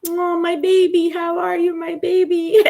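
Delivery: put-on high, loud cooing baby-talk. Imitating a bandmate doting on his baby brother, high cooing. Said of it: "[name]'s like".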